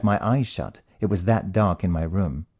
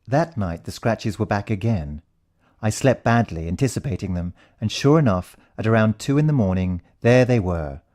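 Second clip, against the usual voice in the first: usual speaking voice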